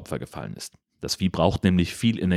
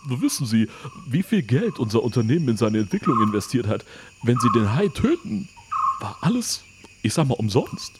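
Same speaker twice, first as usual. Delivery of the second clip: leicht verstellte Stimme eines skrupellosen Chefs; kalter Firmenchef; 4 semitones higher than usual. Acted. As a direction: ein Hauch von herablassendem Bösewicht. Mister Quan rechtfertigt sich. (leicht verstellte Stimme, herablassend)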